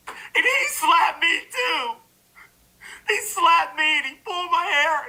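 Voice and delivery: high, slow mock sobbing; mock crying. Mocking the accusers with fake sobbing high. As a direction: fake cry like a wronged woman.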